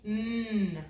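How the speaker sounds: "Mm" as a held "nnn"; letter sound. Shown as a demonstration. Teacher showing the N sound, "Mm" as drawn-out "nnn". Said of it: "Make the N sound".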